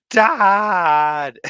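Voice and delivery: ordinary talk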